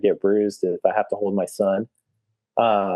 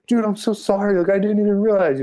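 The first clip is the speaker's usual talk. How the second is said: much higher pitched mock apology; hammed-up sheepish voice; groveling apology; 10 semitones higher than usual. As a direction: squeak out a sorry like a scolded kid. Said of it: "and the guy's like".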